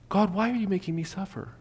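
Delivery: high, soft put-on lament; mock plaintive complaint. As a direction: complain to God like a hurt child. Acts a believer's complaint to God.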